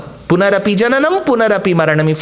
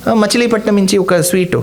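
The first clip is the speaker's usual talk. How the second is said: ordinary talk, nothing marked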